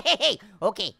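ordinary talk

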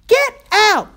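high, full-force scream; screamed order. A narrator's reading. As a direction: scream at it to get out. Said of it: "she screamed".

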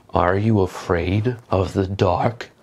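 low, slow creepy voice; spooky voice. Pretend. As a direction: tease them like a ghost story. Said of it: "For the sake of being thematic".

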